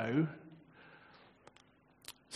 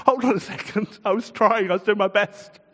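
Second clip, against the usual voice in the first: louder, higher, indignant pushback; defensive protest; 6 semitones higher, 6 dB louder than usual. Acted. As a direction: protest you were trying your hardest. Peter voiced protesting Jesus' rebuke.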